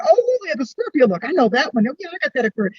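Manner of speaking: high-pitched, exclaiming delight; excited recognition. Retold or imitated. Astrologer recognising her client's Scorpio look, excited. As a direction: cry out in knowing recognition.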